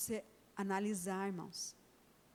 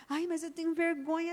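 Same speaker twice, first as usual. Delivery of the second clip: put-on higher, whiny little-girl voice; a small daughter embarrassed to phone her leader; shy little girl; 5 semitones higher than usual. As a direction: play the bashful child.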